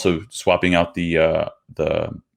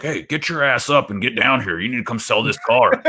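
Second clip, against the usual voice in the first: higher-pitched mock scolding on the phone; mildly hammed-up stern phone call; stern grandfather; 5 semitones higher than usual. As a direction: lightly imitate a stern old man ordering someone over. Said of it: "being pretty stern with him".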